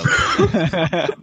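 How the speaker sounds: slow, laughing through the words; laughing while talking. Real, not acted. Laughing mid-sentence.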